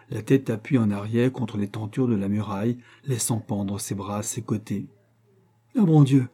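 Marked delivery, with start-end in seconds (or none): 5.8-6.3 sudden cry, higher and louder; startled exclamation. A narrator's reading. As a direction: blurt it out in surprise. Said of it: "s'écria tout à coup Luca Dolci".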